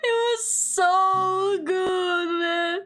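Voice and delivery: high, slow, drawn-out gushing; amused gushing. Real, not acted. Still laughing about the movie.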